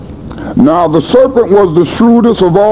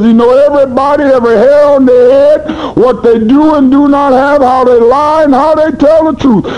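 full-force preaching; 6 semitones higher than usual. Acted. Preacher crying out to the seminary-trained young pastors, full force. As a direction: preach it hard, voice climbing.

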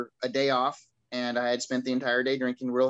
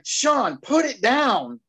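high, loud, drawn-out retold command; exasperated police order; 9 semitones higher, 6 dB louder, much slower than usual. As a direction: call his name, then slowly stretch the order. Officer repeats order as he chugs.

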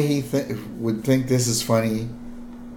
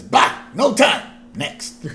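high, loud, curt dismissal; 6 semitones higher, 3 dB louder, slower than usual. Acted. Boss waving away his assistant, curt and dismissive. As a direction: brush them off like an irritable boss. Burns cutting off Smithers.

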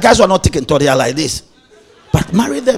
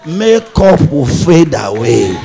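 loud, slow preaching; preaching at full force; 7 dB louder, much slower than usual. Real, not acted. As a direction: hammer the warning home slowly. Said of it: "preaching at full force".

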